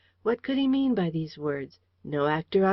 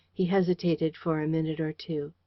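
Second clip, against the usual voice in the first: ordinary delivery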